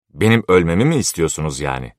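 usual speaking voice

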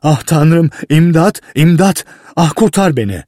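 put-on trapped boy screaming beside a lit fuse; begging in terror. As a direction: plead for your life. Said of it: "diye yalvardı".

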